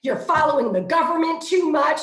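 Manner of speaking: 模仿愤怒女儿，音调更高、声音更响的抱怨; 演出十几岁女儿的怒气，一句比一句高; imitating angry daughter. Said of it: "oh, so angry".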